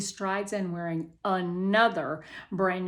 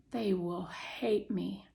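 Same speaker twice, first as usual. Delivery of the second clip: softer and slow, glum; 6 dB quieter, slower than usual. Acted. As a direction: say it with no hope. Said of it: "I type glumly".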